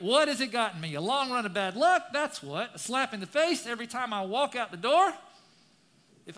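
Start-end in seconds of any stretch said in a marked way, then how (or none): 0.0-5.1 louder, higher complaint; impassioned reading. Acted. Preacher reads the psalmist's complaint with force. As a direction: lament it with rising force.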